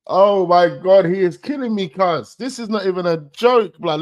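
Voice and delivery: loud, high, amazed outburst; overwhelmed by how funny it is; real hyped reaction; excited laughing disbelief. Real, not acted. Cracking up at the diss.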